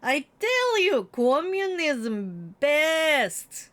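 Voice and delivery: put-on gloating villain chuckle with accent; mock Putin laugh. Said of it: "there's Putin just laughing at us, going".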